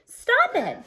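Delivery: high, loud cry to stop; sharp cry. Acted. Teacher yelling across the smoky parking lot, high and loud. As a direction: make them stop right now. Teacher shouts over car smoke.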